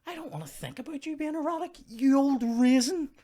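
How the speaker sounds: hammed-up squeamish complaint; mock disgust. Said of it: "I'm like".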